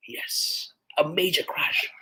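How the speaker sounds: hushed dramatic whisper; breathy stage whisper of the key point. Real, not acted. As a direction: lean in and whisper it like a secret. Dramatic aside about the crash.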